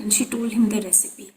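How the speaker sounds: ordinary delivery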